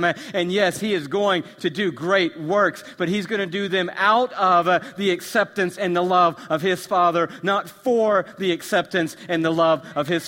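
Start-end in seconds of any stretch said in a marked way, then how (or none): none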